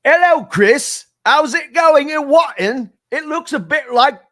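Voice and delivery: loud, high-pitched put-on British accent. Host shows Chris a British accent with weather small talk, hammed-up British accent. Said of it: "I can do a British accent".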